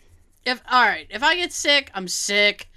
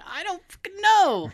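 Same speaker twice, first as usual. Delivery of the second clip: higher, incredulous mock snap back; exasperated retort; 9 semitones higher than usual. Patient snapping back at her baffled doctor, exasperated. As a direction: throw the question back at him, fed up.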